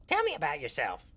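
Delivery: lightly voiced gnome; a hint of a chirpy gnome; Grupham's gnome voice. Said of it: "this is my gnome voice for Grupham".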